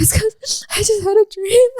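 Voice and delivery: very high mock-crying whine; hammed-up tearful squeak; imitated sobbing. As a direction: wail it like a sobbing retelling. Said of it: "I was literally sobbing uncontrollably".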